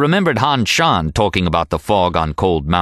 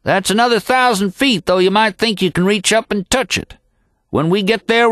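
higher cowboy voice, easygoing; old mountain guide; 13 semitones higher than usual. A narrator's reading. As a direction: chat from the saddle like a lifelong horseman. Happy voiced in the story.

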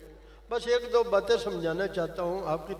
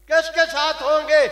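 much louder and higher, a ringing shout; loud repeated question; 8 semitones higher, 12 dB louder than usual. Acted. Preacher repeating his question to the crowd, now shouted. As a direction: hurl the question back at the crowd.

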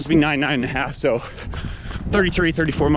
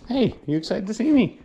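talking to a dog; 7 semitones higher than usual. Real, not acted. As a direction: coo at your dog. Greeting the dog after coming home.

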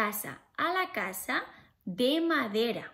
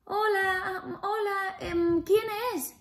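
much higher pleading piggy voice, half sung; squeaky little pig; 8 semitones higher than usual. Acted. Little pig refusing the wolf at the door, high and sing-song. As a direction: squeal it out in a tiny frightened voice. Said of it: "Y el lobo".

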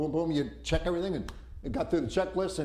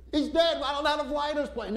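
panicked cry; 9 semitones higher than usual. Retold or imitated. Passenger yelling that the pilot is dead, panicked.

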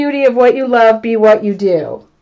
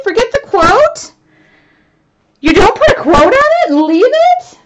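incredulous; 12 semitones higher, 4 dB louder than usual. Real, not acted. Reading viewers' chat, incredulous.

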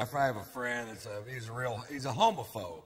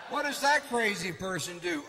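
higher, louder question; mock shout; 7 semitones higher, 3 dB louder than usual. Asking the court about the killer, loud and higher. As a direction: call it out like a judge.

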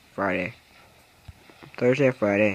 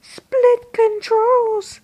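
much higher, slow and drawn out; silly high voice; 5 dB louder, much slower than usual. Pretend. Gamer reading a menu option in a slow squeaky voice.

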